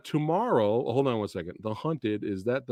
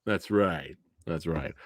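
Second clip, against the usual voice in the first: ordinary talk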